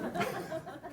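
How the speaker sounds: a soft, breathy little laugh. Real, not acted. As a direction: chuckle quietly at your own joke. Laughs after a self-joke.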